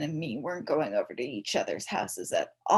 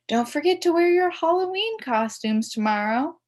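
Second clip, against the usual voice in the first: a school secretary on the PA, higher and louder; crackly loudspeaker voice; 9 semitones higher, 7 dB louder than usual. A narrator's reading. Secretary announcing to the school over the loudspeaker, crackly and raised. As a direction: crackle it out like an old school intercom. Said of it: "crackled over the loudspeaker".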